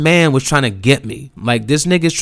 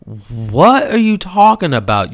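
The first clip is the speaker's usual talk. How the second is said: high, drawn-out mock disbelief; retold incredulity; 5 semitones higher than usual. Family hearing about the car accident, retold disbelief. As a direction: imitate a baffled family.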